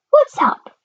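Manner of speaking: very high little voice; Mister Small. A narrator's reading. The small one asking his glum friend, tiny high voice. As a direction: squeak the question in a tiny voice. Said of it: "said Mister Small".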